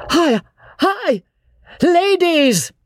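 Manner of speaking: very high, drawn-out alarm; urgent warning call. A narrator's reading. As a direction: call high to friends far off. Beebe warns of ladies approaching.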